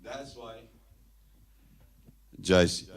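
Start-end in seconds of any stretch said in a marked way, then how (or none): 2.4-2.9 a sudden booming call of Jai Shri; a blast of a greeting, too loud for the mic. Real, not acted. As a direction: call it out to the whole hall. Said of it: "bit loud, bit loud".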